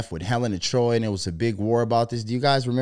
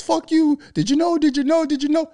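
much higher, louder squeaky repeated question; mock squeaky commenter; 5 dB louder than usual. Mocking the commenter who sent six books, much higher and louder. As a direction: pester like a shrill know-it-all.

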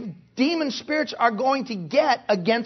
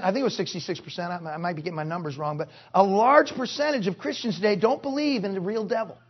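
ordinary talk, nothing marked

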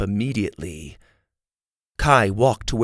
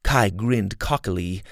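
ordinary delivery

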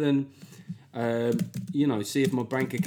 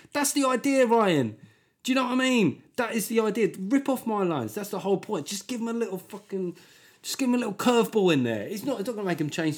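excited; 9 semitones higher than usual. Real, not acted. Excited reply to listener.

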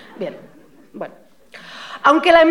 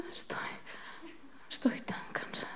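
breathy faint whisper, almost gone; 4 semitones higher, 24 dB quieter, faster than usual. Pretend. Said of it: "le dirás bajito". (susurrando débil)

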